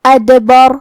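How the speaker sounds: ادبر with the dal bounced and a heavy ra; echoing dal in ادبر; qalqalah on dal. Shown as a demonstration. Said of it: "دال کو ہلاتے ہوئے یہ کلمہ کو پڑھنا ہے".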